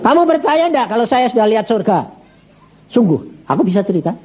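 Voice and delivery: high-pitched, emphatic challenge; insistent challenge. Retold or imitated. Preacher telling sick banker he saw heaven, insistent. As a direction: press him to believe you.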